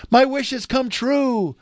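high, sweet cry of joy; delighted cry. A narrator's reading. Princess discovers her beautiful new voice, delighted cry. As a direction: exclaim it high in wonder and delight. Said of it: "she cried in her beautiful new voice".

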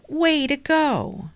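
high, excited congratulation; cheering shout. A narrator's reading. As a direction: cheer a teammate on. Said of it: "shouted the brain".

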